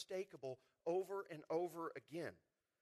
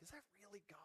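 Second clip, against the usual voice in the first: hushed, unsure whisper; whispered doubt; 3 semitones higher, 18 dB quieter, much faster than usual. Retold or imitated. Preacher voicing a doubter to the congregation, whispered. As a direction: whisper it like you doubt it's really God. Confused believer voiced in sermon.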